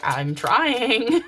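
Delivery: high, loud, laughing "I'm trying"; laughing quote. Retold or imitated. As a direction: laugh it out, caught being shy. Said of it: "And you're like".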